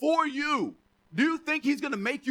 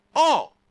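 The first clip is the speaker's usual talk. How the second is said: ALL hit hard, higher and much louder; emphatic punchline; 4 semitones higher, 8 dB louder than usual. Real, not acted. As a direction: land "all" as one loud, raised word. Punchline of mock Greek translation.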